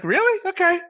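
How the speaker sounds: squeaky stunned reaction; mock surprise. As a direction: sound floored by a kid's sass. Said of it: "and I'm like, I'm like".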